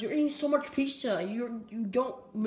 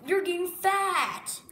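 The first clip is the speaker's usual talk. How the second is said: scolding; 7 semitones higher, slower than usual. Acted. Scolding about diet.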